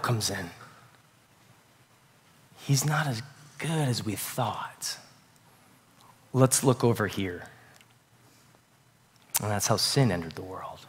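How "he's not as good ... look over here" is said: softer, slow and hushed; hushed doubt. Retold or imitated. Adam and Eve doubting God to each other, hushed murmur. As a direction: murmur it like a dangerous secret.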